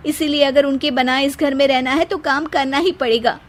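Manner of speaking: high, aggrieved voice poisoning the husband; scheming wife. Acted. Seema complains to Ankit about Anu, high and aggrieved. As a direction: twist the story against the sister.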